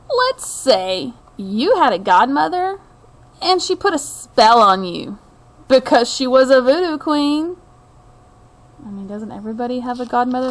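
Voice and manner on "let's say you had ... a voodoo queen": unhurried storybook reading voice; story narration. A narrator's reading. Reading a picture book to viewers, storyteller's narration. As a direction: draw the listener into a tale of old New Orleans.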